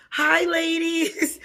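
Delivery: high, slow, excited hello; excited greeting. Real, not acted. Greeting the ladies from her team, excited. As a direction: sing out the hi.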